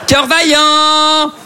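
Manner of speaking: high, very drawn-out film title; hammed-up title call. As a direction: hold each syllable high and long. Repeats translated film title theatrically. (titre clamé, aigu et très étiré)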